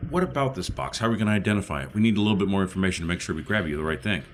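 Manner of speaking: ordinary talk, nothing marked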